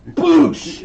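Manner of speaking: loud, high explosive "boosh"; blast sound effect. Acted. Villain firing bolts at a hero, explosive vocal effect. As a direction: burst out the noise of an energy bolt.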